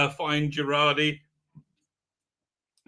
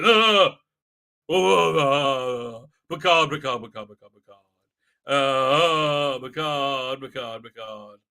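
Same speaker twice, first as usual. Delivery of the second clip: mock anguished cries; 3 semitones lower, 4 dB louder, slower than usual. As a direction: moan and call the name, trailing away. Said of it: "Picard's like".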